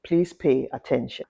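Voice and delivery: ordinary talk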